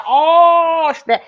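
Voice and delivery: loud, high, drawn-out "oh"; shocked reaction. Retold or imitated. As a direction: react big to juicy gossip. Retelling her shocked reply to friend.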